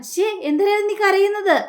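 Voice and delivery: ശ്ശേ, പെട്ടെന്ന് ഉയർന്ന ശബ്ദത്തിൽ ചോദിക്കുന്നു; startled scolding. Acted. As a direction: jump up high, half annoyed. Husband scolds crying wife on phone. (ഞെട്ടലോടെ ശാസിക്കുന്ന ചോദ്യം)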